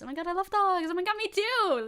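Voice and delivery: ordinary talk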